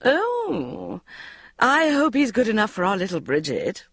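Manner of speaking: high, mock-sweet coo; teasing Una. A narrator's reading. Una teasing Bridget in front of Mark, high and coy. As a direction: coo it with a wink. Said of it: "winking at me as if this was all hilarious fun".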